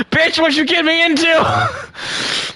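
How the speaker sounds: high, slow, drawn-out mock wail; comic panicked wail. Passenger to the woman who dragged him in, comic panicked wail.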